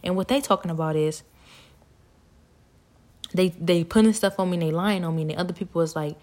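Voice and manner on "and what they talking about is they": normal talking voice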